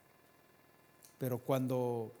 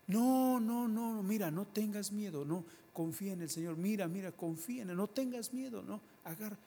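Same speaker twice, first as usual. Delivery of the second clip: high, pleading reassurance; calm a frightened friend urgently; a believer begging someone not to fear; urgent comforting; 8 semitones higher than usual. Retold or imitated.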